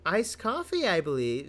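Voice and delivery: high-pitched witness voice; coy April May. Acted. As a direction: lift it into a coy, girlish register.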